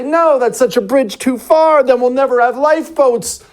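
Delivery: high-pitched, insistent objection; protesting refusal. Acted. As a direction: object as the alarmed people in charge. Voicing officials refusing.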